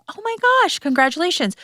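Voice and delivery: sudden high squeal of mock delight; retold excited gasp. Retelling her forced congratulations to a client, high and excited. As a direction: act thrilled for her friend. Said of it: "and I'm like".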